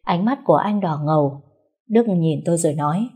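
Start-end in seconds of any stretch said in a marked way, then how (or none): none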